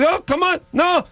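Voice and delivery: very high-pitched mock Israeli woman; Israeli neighbor accent. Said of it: "sixty-year-old Israeli".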